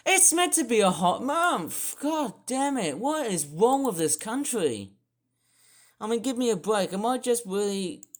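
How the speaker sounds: loud, high-pitched exasperated outburst; ropeable. Real, not acted. Complaining that a hot month is cold, ropeable outburst. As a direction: cry out in angry disbelief. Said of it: "it just makes me feel ropeable".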